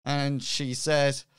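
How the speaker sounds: ordinary talk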